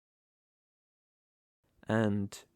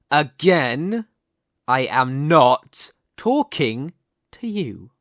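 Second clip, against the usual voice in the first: loud, slow, emphatic warning; forceful villain losing patience; angry rebuke; 9 dB louder, slower than usual. Acted. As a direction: silence the interrupter word by word. Snaps at repeated interruption.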